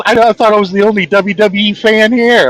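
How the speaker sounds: a hint of an excited fan; mildly imitated kid; other voter. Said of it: "He goes".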